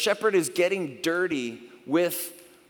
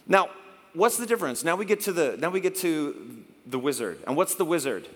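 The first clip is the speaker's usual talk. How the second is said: usual speaking voice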